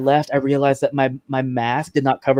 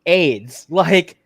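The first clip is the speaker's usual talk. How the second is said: angry retort; 5 semitones higher than usual. Retold or imitated. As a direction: snap back at her, fed up. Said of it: "I was, like, so mad".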